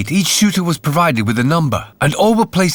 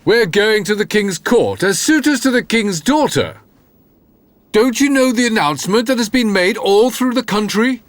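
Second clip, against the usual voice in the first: lightly voiced haughty brothers, a bit higher; a hint of proud older brothers; 7 semitones higher than usual. A narrator's reading. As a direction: tell him with mild superiority. Brothers answer Jack.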